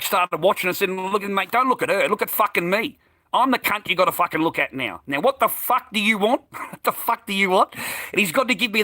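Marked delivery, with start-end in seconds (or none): 1.1-6.4 high-pitched, confrontational challenge; heated demand for attention; retold angry outburst. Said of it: "I went off my head". 6.5-7.7 high, heated challenge; reenacted confrontational question; retold angry outburst. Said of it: "I went off my head".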